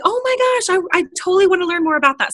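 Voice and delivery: high, fast excited gush; imitating a thrilled friend gushing; mock excited gasp then fast chatter. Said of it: "she's like".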